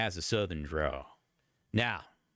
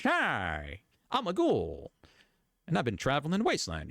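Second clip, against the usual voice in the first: put-on high, quick old-time salesman patter; mock a forties newsreel pitchman; 1940s news salesman; 6 semitones higher than usual. Mocking a ghoul made to sound like a forties salesman, old-time news salesman voice. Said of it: "Not like a nineteen forties news salesman guy".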